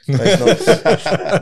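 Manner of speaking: slow laughing denial. Acted. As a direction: drag it out while laughing.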